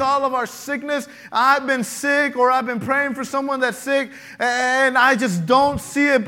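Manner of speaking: rising sermon fervour; full-force preaching. Acted. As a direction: preach it out to the church.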